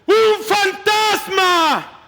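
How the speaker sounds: high, slow panicked cry; terrified shout. Acted. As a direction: drag out the scream as the fear takes hold. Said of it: "Llenos de miedo, gritaron".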